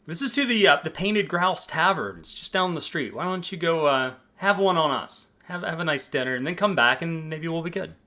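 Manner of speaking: bright, high, eager front desk voice; a cheerful clerk welcoming a guest; chipper hotel clerk. Acted. Receptionist greets the guest checking in, high and cheerful. Receptionist offers tavern coupon.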